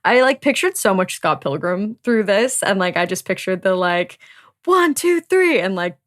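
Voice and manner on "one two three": high, loud imagined count-in; band count-in. Acted. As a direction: kick off the band with a shout. Imitating a band's count-in.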